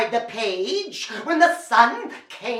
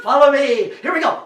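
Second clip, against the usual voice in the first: ordinary delivery, nothing marked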